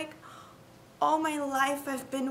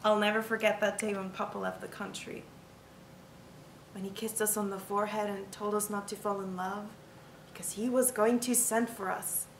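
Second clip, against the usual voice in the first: quiet sorrow; 6 semitones lower, 9 dB quieter than usual. Acted. Quiet sad memory of Papa.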